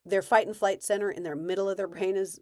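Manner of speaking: normal talking voice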